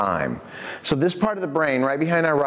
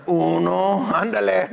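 Spanish-flavoured count; 5 semitones higher, slower than usual. Retold or imitated. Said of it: "It'll probably look more like this".

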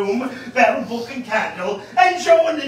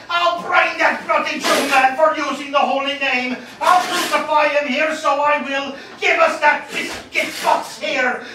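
furious threat; 5 semitones higher than usual. Acted. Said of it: "says he".

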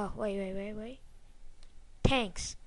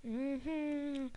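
usual speaking voice

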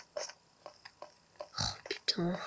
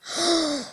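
sharp gasp; 5 semitones higher, 5 dB quieter than usual. Real, not acted. Finds a dead body.

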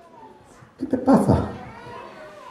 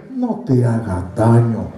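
lower, slow, gentle words; gentle reassurance; 10 semitones lower, slower than usual. Retold or imitated. Paul calms the jailer about to harm himself, slow and gentle. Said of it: "no estaba ahí diciendo".

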